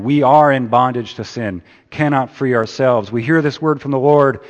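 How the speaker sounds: loud, high and driving; full-force preaching. Acted. Pastor urging the congregation, loud and high. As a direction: proclaim it to the congregation.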